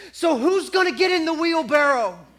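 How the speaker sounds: loud challenge. Retold or imitated. Walker's challenge to the crowd.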